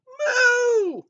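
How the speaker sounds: long, high, loud moo; cow moo. A narrator's reading. Cow greets Blue, loud high moo. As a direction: moo it out with full force. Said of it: "Cow said".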